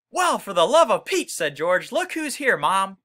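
loud high excited exclamation; excited surprise. Acted. George calling to Mom on finding Stewart, excited high shout. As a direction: burst out amazed at the find.

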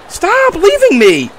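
high, loud, slow plea; stretched-out, whiny shout; desperate yell. Real, not acted. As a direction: call after him as he leaves. Friend walks away, calling after.

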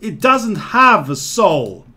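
loud, slow and emphatic; exasperated retort. Acted. Gar answers the companion pleading for a door, loud exasperation. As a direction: spell it out loudly for someone dim.

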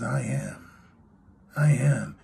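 soft, quiet, held-back I am; hushed reply. Acted. Preacher voicing Jesus answering soldiers, hushed. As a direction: let it drop almost to a murmur.

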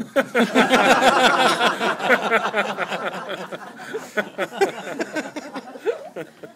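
high loud cackle of laughter; loud laughing. Real, not acted. Comic laughing at his own joke, loud high laugh. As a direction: crack up at the punchline.

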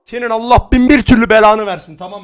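loud furious curse; angry cursing. Real, not acted. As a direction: curse them out. Cursing the troll player.

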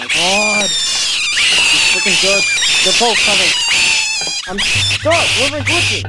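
loud panicked yelling at the game; frustrated yelling. Real, not acted. Gamer stuck between chasing monsters, loud panicked yell. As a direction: yell in real frustration at the glitch.

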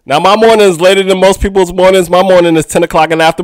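normal talking voice

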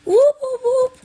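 high, loud celebratory whoops; excited whooping. Real, not acted. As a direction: cheer the win out loud. Celebrating a success.

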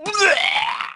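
short high barking laugh; mocking shout laugh. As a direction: laugh in his face.

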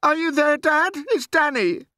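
very high, loud desperate call; trembly pleading. A narrator's reading. Boy getting no answer from his father, trembly pleading. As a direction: call out, close to despair. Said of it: "my voice began to go all trembly".

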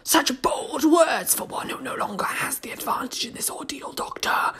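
a sour, wet whisper of a monster; sour wet creature voice. Acted. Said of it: "smiled the Zygon maliciously".

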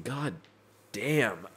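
ordinary talk

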